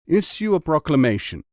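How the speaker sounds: loud kingly order; royal command. Acted. King orders his court to find silk, commanding. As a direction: issue the decree with authority. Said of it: "said the king".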